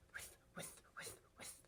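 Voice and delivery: breathy whispered whisk, whisk. Shown as a demonstration. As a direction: whisper it like a sprinkler. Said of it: "steady whispering sound like this".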